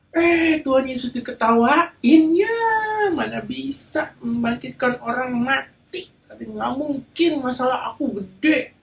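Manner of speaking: high, scoffing disbelief; high mocking voice of the doubters; mocking crowd. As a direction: laugh him off: he can't raise the dead. The crowd mocks Jesus.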